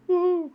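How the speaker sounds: high, loud cheer of joy; thrilled whoop. Shown as a demonstration. Teacher acting out feeling thrilled, loud whoop. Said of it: "I was thrilled".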